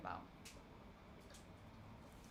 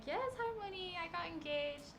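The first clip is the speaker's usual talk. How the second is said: high polite reply; 7 semitones higher than usual. Retold or imitated. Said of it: "I was like".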